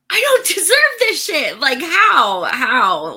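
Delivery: bursting with grateful disbelief; overwhelmed laughing disbelief. Retold or imitated. Host telling viewers about a donated computer, laughing overwhelmed disbelief.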